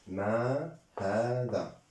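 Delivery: "ما هذا" slowly, each word drawn out; slow model. Shown as a demonstration. Teacher modelling "ما هذا" for French-speaking learners, slow and drawn out. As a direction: stretch the long vowels of "ما هذا".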